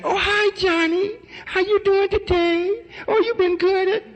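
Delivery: very high, drawn-out coaxing falsetto; changed voice for children. Shown as a demonstration. Man changing his voice to greet little Johnny, high put-on sweetness. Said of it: "He got to change his voice when he's talking to the little children".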